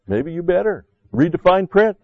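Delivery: high, loud warning to read; urgent exhortation. Real, not acted. Pastor urging listeners, high and loud. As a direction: push them hard to read it. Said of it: "preaching exhortation".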